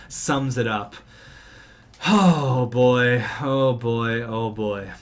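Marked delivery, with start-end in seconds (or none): 2.1-4.9 dismayed sigh. Real, not acted. Dismay after losing.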